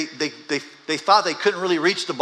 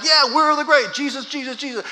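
mock pep rally cheer; 12 semitones higher, 5 dB louder than usual. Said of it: "We could, like".

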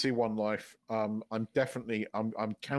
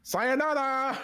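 high, loud Sayonara; bowing out in hammed-up Japanese; mock Japanese farewell; 12 semitones higher, 4 dB louder, slower than usual. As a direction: shout it like a cartoon farewell. Comic Japanese sign-off.

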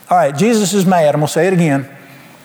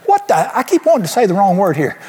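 ordinary talk

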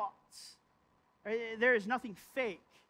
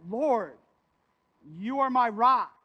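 loud, earnest cry to the Lord; loud plea; 8 dB louder than usual. Retold or imitated. Preacher voicing David crying out to God. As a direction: call out to God with force.

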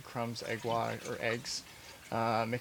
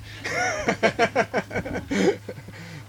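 long high, loud laugh; 10 semitones higher, 6 dB louder than usual. Real, not acted. Chef joking about a stubborn oven, laughing heartily.

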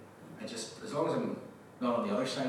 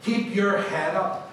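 higher, louder, weighty declaration; full-force preaching; 6 semitones higher, 8 dB louder than usual. Real, not acted. Preacher speaking a promise over the congregation, forceful preaching. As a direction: drive the promise home emphatically.

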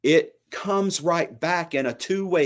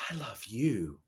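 very soft, hushed tenderness; whispered; 3 semitones lower, 12 dB quieter than usual. Acted. Preacher voices God as a loving parent, whispering. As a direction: hush it right down, as a patient parent would. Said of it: "And he whispers".

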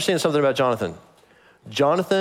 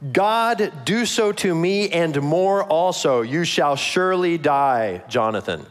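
Saul's oath; 4 semitones higher, slower than usual. Acted. Saul to his son Jonathan, a slow raised vow. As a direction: swear it slowly and solemnly. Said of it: "And Saul said".

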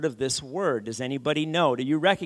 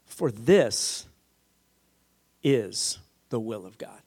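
broken up with long pauses, dropping lower; solemn, measured repeat of scripture; deliberate emphasis; 5 semitones lower, slower than usual. Real, not acted. Preacher repeating the verse so it sinks in, slowly and deliberately.

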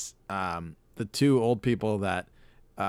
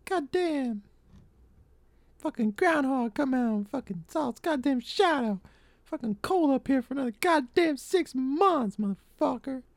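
much higher, put-on cranky voice; Jim Cornette impression; 14 semitones higher than usual. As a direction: growl out a crusty old wrestling manager's rant. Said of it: "I haven't done Jim Cornette in a while".

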